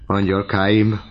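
joyful cry, pitched a little up. A narrator's reading. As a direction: call out lovingly to your daughters. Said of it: "kiáltott Gorió apu".

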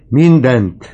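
second "mindent" pushed to the peak; old man's climax on "mindent". Acted. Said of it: "kiáltott az öreg".

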